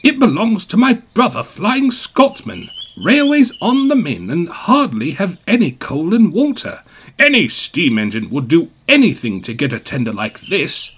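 pleading. A narrator's reading. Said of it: "Gordon pleaded".

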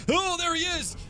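high-pitched panic yelling; panicked cries. Real, not acted. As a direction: plead frantically as the attacker closes in. Panicking as gorilla attacks.

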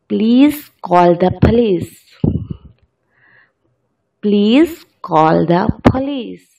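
very slow, stressing please and police; slow example sentence. Shown as a demonstration. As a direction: read it out slowly for learners. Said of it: "please में एस की sound ... जेड में".

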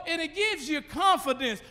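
usual speaking voice

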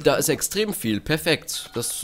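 usual speaking voice

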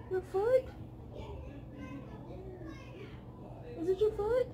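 high, soft coaxing for a frightened dog; soothing pet voice. Real, not acted. Owner coaxing her shaking dog from under the bed, high and soft.

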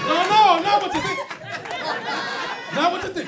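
high loud denial; loud protest. Real, not acted. Comic pleading with the laughing crowd, loud and high. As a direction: cut them off, loud and high.

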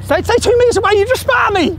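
high, heated shout; angry yelling. Real, not acted. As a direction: yell at him to back off, outraged. Yelling at the man to back off.

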